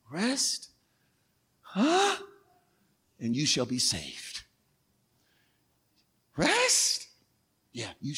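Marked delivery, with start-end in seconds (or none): 0.1-0.7 much softer, gentle rest; soft divine reply. Acted. God gently answering the anxious believer, soft. 1.6-2.1 confused huh. Acted. Believer's confused reaction. 6.4-7.1 high, softer disbelieving rise; mock disbelief, pitched way up; incredulous question. Believer doubting God's call to rest, incredulous.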